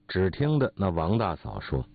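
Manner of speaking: plain reading voice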